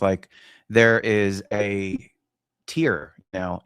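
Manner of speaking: ordinary talk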